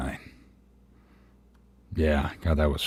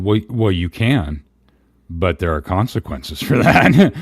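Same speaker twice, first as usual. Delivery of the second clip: pointed comeback; 4 semitones higher, 6 dB louder than usual. Retold or imitated. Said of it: "And I said".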